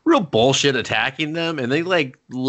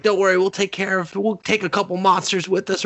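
high, breezy mock bravado; gung-ho, cheerful; 9 semitones higher than usual. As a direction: play eager volunteers, pitched up and cheerful. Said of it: "Everyone's, like, real gung-ho, cheerful".

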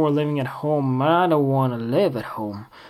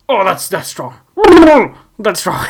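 mock jolt; 3 semitones higher, 8 dB louder than usual. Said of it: "You're like".

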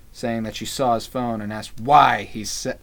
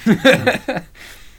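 high loud burst of laughter; 10 semitones higher, 8 dB louder than usual. Real, not acted. Host laughing at cohost's Drago quote, loud laugh. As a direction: break up laughing.

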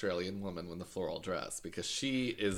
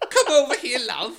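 loud, high Cockney put-on with a laugh; Cockney accent; 14 semitones higher, 11 dB louder than usual. Measuring lady calling a customer over, loud Cockney. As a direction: belt it like a Cockney shop girl. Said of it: "That's just Cockney".

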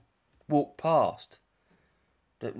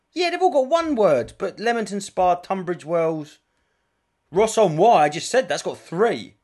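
higher and louder, building indignation; excited rant; 8 semitones higher, 8 dB louder than usual. Real, not acted. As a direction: push it up like a comic rant. Ranting about place names.

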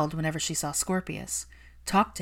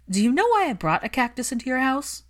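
higher, louder confronting challenge; 8 semitones higher, 6 dB louder than usual. Acted. Hermione confronting her employer, sharp and loud. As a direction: throw the question at him hard.

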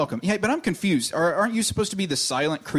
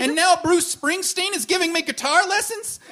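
high giddy rush; 9 semitones higher than usual. Acted. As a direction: squeal with delight. Giddy at wish visions.

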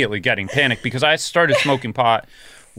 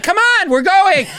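much higher, louder, slow call across the set; a crew member calling out to get moving; hurried call; 7 dB louder than usual. Retold or imitated. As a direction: summon them to set with impatience. Crew hurrying them to set.

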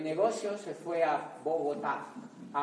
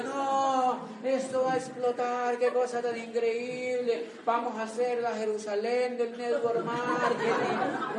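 mock hyped crowd; 5 semitones higher than usual. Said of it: "La gente loca en un auditorio".

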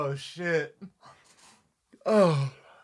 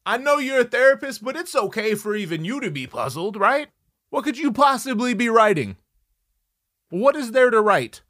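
higher, louder frustrated outburst; exasperated yell; 7 semitones higher, 4 dB louder than usual. Retold or imitated. The boyfriend shouts at the therapist, higher and louder. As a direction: throw up your hands and shout it. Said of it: "I'm like".

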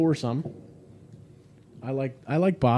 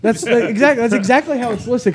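louder, higher protest, defending the label; insistent; 9 semitones higher, 6 dB louder than usual. Real, not acted. Host insists the label really lists it that way, louder and higher. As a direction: argue the point, getting loud and high. Insisting on how it's listed.

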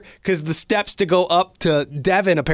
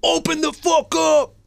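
angry stranger yelling; 11 semitones higher than usual. Retold or imitated. A knife-wielding stranger threatens [name] at midnight, high mock yell. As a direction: yell it like a crazed man banging on the door. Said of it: "I got this pound on the door".

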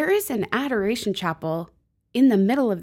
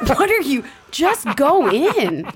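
high, loud, laughing exasperation; mock exasperation; 7 semitones higher, 6 dB louder, slower than usual. Woman teasing herself for sitting outside chapels, mock exasperated. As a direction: scold in playful disbelief, pitched up and loud.

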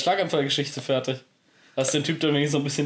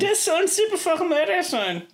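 high mocking voice. Mimics the line in a put-on voice.

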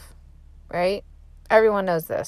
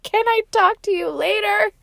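much higher, teary plea; crying on the phone, asking to talk later in a much higher voice; retold tearful plea; 13 semitones higher than usual. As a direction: squeak it out like you're about to cry. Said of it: "I just start bawling".

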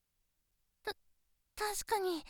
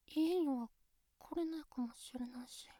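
whispered fear; 7 semitones lower, 9 dB quieter than usual. Acted. Fearing never visiting again.

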